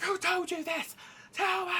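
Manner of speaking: high, urgent shouted demand; drill-sergeant grilling. Acted. King interrogating his son, high shouted demand. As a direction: drill him in a high put-on voice. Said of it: "drilling him like a drill sergeant".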